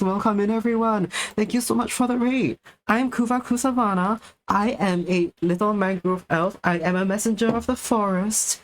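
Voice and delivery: bubbly cute welcome to raiders; cute anime streamer. Acted. Raid welcome in higher cute voice.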